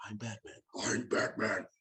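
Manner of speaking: husky growl in need of throat-clearing; low, hoarse superhero rasp, played for laughs; Batman. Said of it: "needed ... to clear his throat when he was Batman".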